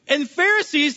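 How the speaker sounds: ordinary talk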